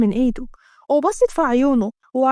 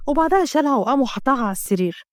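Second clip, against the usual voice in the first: ordinary delivery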